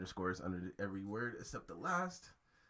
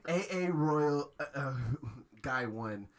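slow spelling; 5 semitones higher, 8 dB louder, slower than usual. Real, not acted. Repeating handle slowly, louder.

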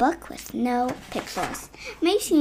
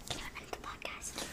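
whisper; 22 dB quieter than usual. Real, not acted. Whispered aside to dad.

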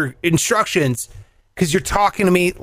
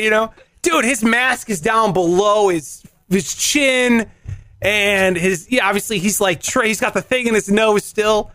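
ordinary talk, nothing marked

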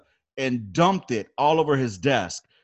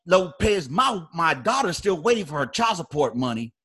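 raised-pitch mock accusation; imitating first sergeant; 5 semitones higher than usual. First sergeant baiting Lopez to break his bearing, mock indignant. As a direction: needle him in a higher, put-on sergeant voice. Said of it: "'Cause that's how he talks".

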